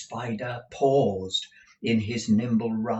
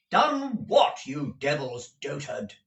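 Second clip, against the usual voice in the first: high, angry burst falling to a growing insult; losing patience with an old fool; exasperated demand; 4 semitones higher than usual. Acted. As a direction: start high and angry, then drop for the insult. Ralph insults Gride, demanding answer.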